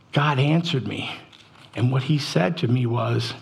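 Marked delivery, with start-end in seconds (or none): none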